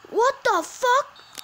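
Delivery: high, loud, stretched out; shocked disbelief. Real, not acted. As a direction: scream it in disbelief. Repeated, higher disbelief.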